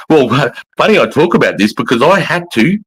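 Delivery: ordinary talk, nothing marked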